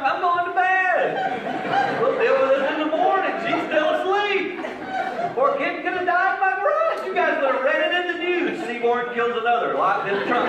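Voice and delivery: comic give-up, done for the night; mock exasperation. Husband to wife after the boy runs off, mock exasperated.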